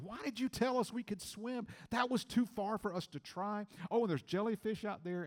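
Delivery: high, indignant complaint; angry at the captain. Retold or imitated. Swimmer scolding the captain after the jellyfish scare, indignant. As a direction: tell him off for letting you swim. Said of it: "I let him know I was mad".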